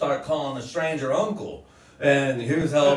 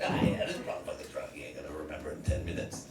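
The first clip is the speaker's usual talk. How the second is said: low, very soft private remark; hushed aside; 11 semitones lower, 12 dB quieter, faster than usual. Retold or imitated. Nephew to himself about his drunk uncle, hushed aside. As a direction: say it under your breath so he can't hear.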